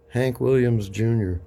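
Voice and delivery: ordinary talk, nothing marked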